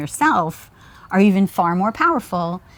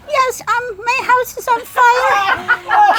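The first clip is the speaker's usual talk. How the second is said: put-on very high, slow old lady voice; old lady accent; slower than usual. Kid faking a fire call to 911, old lady voice. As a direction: imitate an elderly woman on the phone. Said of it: "I put on my best old lady accent".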